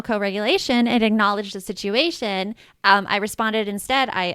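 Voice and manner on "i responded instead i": ordinary talk, nothing marked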